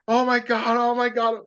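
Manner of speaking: panicked scream. Retold or imitated. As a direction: cry out like the chute won't open. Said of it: "he was screaming".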